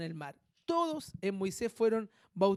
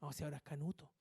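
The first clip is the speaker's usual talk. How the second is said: softer, sneering aside of the gossip; hushed mocking gossip; muttered mockery; 5 semitones lower, 11 dB quieter, faster than usual. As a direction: mutter it like a scornful relative.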